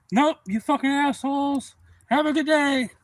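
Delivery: high, loud and slow mocking reply; gleeful grumpy grandpa. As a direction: sneer a cheery goodbye.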